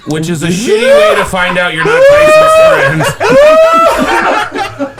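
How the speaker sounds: loud, high, slowed by real laughter; laughing hard. Real, not acted. Comic realizing they're not Tyson's friend, laughing hard. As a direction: push the line out through big laughs. Cracking up at own anecdote's punchline.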